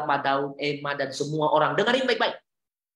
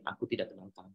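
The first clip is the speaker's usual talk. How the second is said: much softer, solemn voice of the Lord; hushed grave rejection; 7 semitones lower, 13 dB quieter, much faster than usual. Retold or imitated. Preacher voicing the Lord telling a servant he is unknown, hushed. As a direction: let it fall quiet and final. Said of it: "Tuhan bilang ini".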